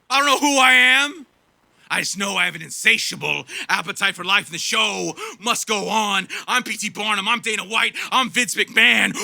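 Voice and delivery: high, swelling mock-preacher voice of a smug billionaire; mock tycoon cowboy. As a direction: ham up a grandiose tycoon selling himself. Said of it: "that's me being a cowboy".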